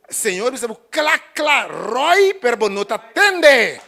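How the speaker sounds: loud, high fervent preaching; preaching at full force. Acted. Preacher crying out to the church, loud and fervent. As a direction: cry it out to the congregation.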